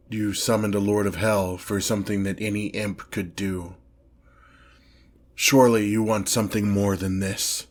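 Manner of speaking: normal talking voice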